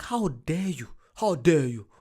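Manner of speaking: hammed-up how dare you; dramatic mock offence; mock outrage. Retelling his outrage at the costly drink. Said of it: "I'm like".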